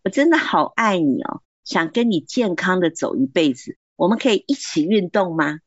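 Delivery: woo her gently toward exercise; husband sweetly coaxing his wife to exercise together; sweet encouraging. Shown as a demonstration. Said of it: "激励的说法就可以这样说".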